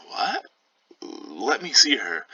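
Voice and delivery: a father hit by the news, disbelieving; shocked father. Acted.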